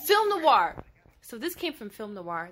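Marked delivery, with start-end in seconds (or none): 0.0-0.7 high, loud burst of recognition; excited exclamation. Real, not acted. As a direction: call it out, pitched way up and much louder. Eagerly confirms listener's film noir guess.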